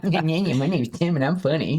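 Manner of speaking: mock him like a playground taunt; childish, exaggerated mockery; mocking co-host. Mocking the co-host in his name.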